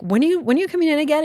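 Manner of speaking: rushed question. Retold or imitated. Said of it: "And I'm like".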